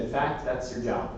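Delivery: quiet and slow, letting it land; soft, slow punchline. Retold or imitated. Speaker to three hundred students, soft and slow. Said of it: "he looked at the room".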